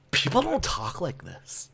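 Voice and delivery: ordinary talk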